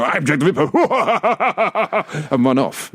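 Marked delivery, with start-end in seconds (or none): 0.0-0.7 lightly hammed waxwork villain; quick mock-menacing announcement; scary Jack the Ripper. Waxwork actor springing a scare, lightly hammed menace. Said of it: "So you go". 0.8-2.3 high, pulsing mock-evil cackle; long hammed-up villain laugh. Jack the Ripper scaring tourists, mock-evil laugh.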